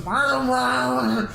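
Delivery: mushy jawless mumbling grunts; imitating a hero with no jaw, slurred murrs; broken-jaw mumble. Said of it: "You can barely make out what he's saying 'cause his jaw got broke".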